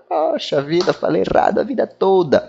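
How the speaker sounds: mock-dismayed at one's own long mistake; retold dismay. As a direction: act out a rueful discovery. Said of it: "eu também achei diferente".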